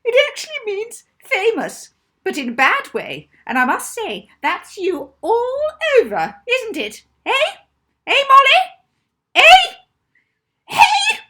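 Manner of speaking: very high squeaky voice, sneering; squeaky teacher voice. A narrator's reading. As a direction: squeak it out like a shrill spiteful teacher. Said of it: "came her squeaky voice".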